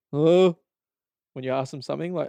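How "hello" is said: louder, higher flat greeting; barely bothered; bored staff. Retold or imitated. JB Hi-Fi staff greeting a customer, mock bored. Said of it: "the staff are either like".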